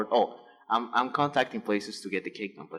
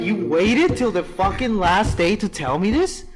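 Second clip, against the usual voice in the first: higher, louder, slow outraged retelling; outraged yell; 9 semitones higher, 7 dB louder, slower than usual. Retold or imitated. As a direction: throw the disbelief back at them, drawn out. Retold outraged reply to store.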